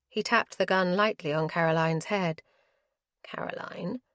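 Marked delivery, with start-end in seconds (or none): 3.2-4.0 whispered name; hushed. Acted. Lamb quietly ordering Caroline, hushed. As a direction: breathe her name. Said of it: "villain addresses hostage".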